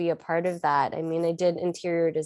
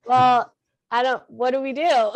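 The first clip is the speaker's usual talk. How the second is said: higher, louder awkward reply; flustered artist; 6 semitones higher, 5 dB louder than usual. Retold or imitated. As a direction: play it sheepish and unsure. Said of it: "and you're kinda like".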